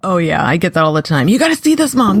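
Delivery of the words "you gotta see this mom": put-on high, eager kid pestering. Kid urging mom to watch a video, high and eager. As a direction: beg like a kid dying to share.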